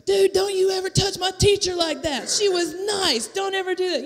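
put-on a boy telling off a classmate for touching the teacher; indignant kid. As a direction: stick up for the teacher like an outraged seventh grader. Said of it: "this other kid going".

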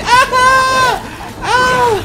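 very high, loud yelps; yelps of pain. Pretend. Player hurt fighting these guys, high pained yelps. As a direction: shriek each hit out high.

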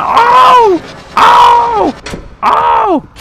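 high-pitched startled "oh" cries; startled scream. Real, not acted. Player startled by the game, high cries. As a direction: cry out high in alarm.

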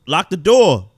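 loud urgent shout. Acted. Camper yelling at teammate to lock door. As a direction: yell it at your teammate.

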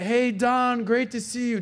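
high, bright mock greeting. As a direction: greet him like an old pal. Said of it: "comes up to".

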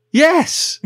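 high, loud thrilled yes; really excited. Retold or imitated. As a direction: blurt it out, thrilled. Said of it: "Like, really excited".

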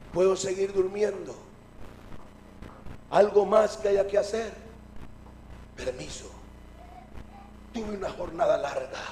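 low, soft tired murmur; drowsy, understated request; sleepy, weary. Acted. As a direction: excuse yourself to go back to sleep. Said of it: "somnoliento todavía".

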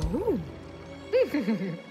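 low villain chuckle; evil chuckle. Acted. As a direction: snicker with menace. Villain gloats over the show.

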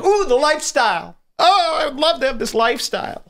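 high swooning voice, mocking online losers; mock dreamy loser. Mocking wannabes who dream of the lifestyle, high and swooning. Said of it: "And they're like".

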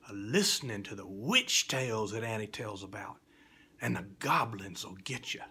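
spooky storyteller's reading, swinging from whisper to loud; theatrical recitation for children; dramatic poem recitation. A narrator's reading. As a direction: tell it like a fireside ghost tale.

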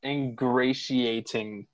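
Ingratiating repeated slowly, syllable by syllable, in-GRAY-shee-ay-ting; learning the word Ingratiating, say it slowly back; slow careful repeat. Shown as a demonstration. Narrator repeats the word he stumbled on, slowly sounding it out. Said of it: "Say it, say it again".

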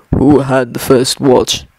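a dwarf king asking calmly; Thorin's voice. Acted.

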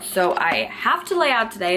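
normal talking voice

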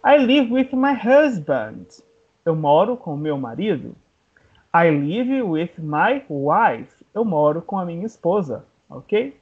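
ordinary talk, nothing marked

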